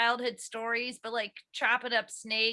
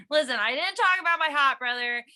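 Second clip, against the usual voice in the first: ordinary delivery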